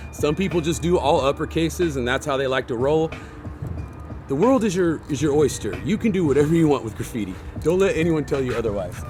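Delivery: ordinary delivery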